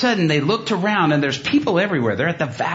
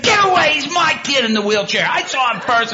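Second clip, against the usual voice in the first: mock high, loud claim of a kid brother; a hint of a bossy sibling shoving in; 11 semitones higher, 4 dB louder than usual. Older brother pushing his brother away, mock bossy shout.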